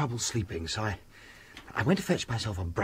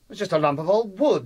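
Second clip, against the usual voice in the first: dismissive; 9 semitones higher, 6 dB louder than usual. Acted. Impatient with his fussing friend.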